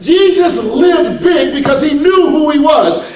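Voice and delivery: high, loud, shouted sermon climax; full-force preaching. Real, not acted. Preacher telling congregation how Jesus lived, full-force preaching. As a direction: drive it home at the top of your voice.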